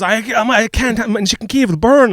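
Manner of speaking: high, loud mock-frantic cry; hammed-up frantic yelp about dinner; mock panic. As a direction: panic over the chicken Kiev.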